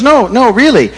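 put-on high, loud old church lady; a loud, high elderly saint delivering the zinger; old lady punchline. Elderly lady's punchline in joke.

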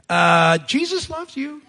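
mock polite restraint; forced niceness. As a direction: pretend to be sweet through gritted teeth.

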